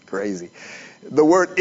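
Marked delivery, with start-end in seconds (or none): 0.0-0.5 whispered laugh. Real, not acted. Laughing aside during explanation.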